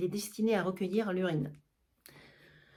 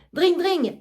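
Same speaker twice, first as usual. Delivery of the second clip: higher ringing "dring, dring" like a hand bell; bell imitation; 7 semitones higher, 5 dB louder than usual. Argan ringing for his servants, mimicking a bell. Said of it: "Il sonne une sonnette".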